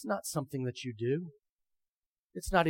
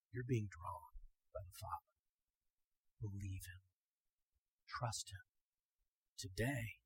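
very soft, low, with long pauses; hushed appeal; 6 semitones lower, 16 dB quieter than usual. Real, not acted. Preacher urging listeners to trust today, hushed appeal. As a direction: drop almost to nothing and plead gently.

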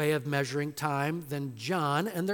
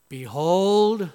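loud, ringing announcement; proclaiming; 2 semitones higher, 9 dB louder than usual. Acted. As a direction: proclaim it to the crowd. Pilate presents Jesus to crowd.

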